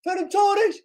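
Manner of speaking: very high yell to a player; excited shouting. Real, not acted. Fan urging on Ferran Torres, excited yell. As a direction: yell the name in real excitement.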